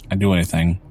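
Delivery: ordinary delivery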